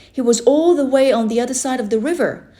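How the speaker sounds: plain reading voice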